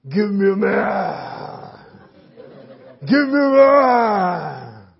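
slow, drawn-out giant voice; Goliath's voice. Retold or imitated. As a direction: stretch it out like a taunting giant. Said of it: "how the giant voice would be".

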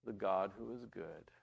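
ordinary talk, nothing marked

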